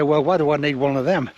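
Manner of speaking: ordinary delivery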